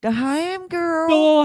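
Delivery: high, slow, drawn-out character voice; silly ham girl voice. Pretend. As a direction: stretch it out in a goofy high voice.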